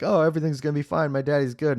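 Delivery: high, fast sing-song reassurance; mocking fake comfort. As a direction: sarcastically parody the empty comfort. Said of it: "make him feel like".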